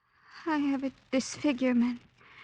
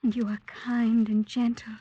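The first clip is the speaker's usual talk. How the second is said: ordinary talk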